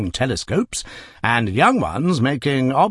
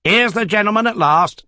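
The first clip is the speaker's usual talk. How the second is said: higher, louder sham courtesy; mock politeness; 10 semitones higher, 4 dB louder than usual. Cab driver greeting the new arrival with mock politeness. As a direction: greet him with mocking politeness. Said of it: "with mock politeness".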